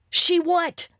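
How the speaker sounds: sharp high demand. Acted. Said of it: "demanded Crystal".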